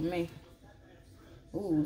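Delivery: normal talking voice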